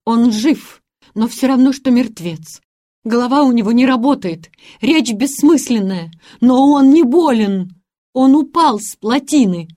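shaken tearful witness. A narrator's reading. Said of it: "раз или два смахнув слезинку".

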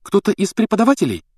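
high, eager follow-up question; insistent. A narrator's reading. Hermione guessing who cast the Patronus, insistent. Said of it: "настаивала Гермиона".

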